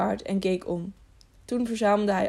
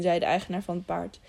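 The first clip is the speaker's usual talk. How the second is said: usual speaking voice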